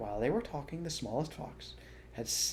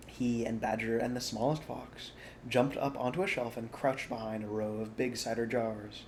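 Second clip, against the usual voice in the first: usual speaking voice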